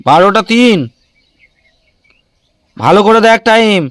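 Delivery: loud, high-pitched parental telling-off; stern scolding. Real, not acted. Parent corrects child's time. (চড়া গলায় বকুনি)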